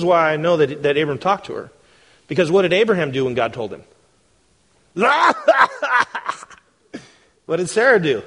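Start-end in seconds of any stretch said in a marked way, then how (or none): 4.9-6.3 imitated laugh. Abraham laughing.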